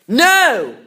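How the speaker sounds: loud, high cry of No!; child bursting out from behind the door; shout. A narrator's reading. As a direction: yell it out to stop her. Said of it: "I shouted".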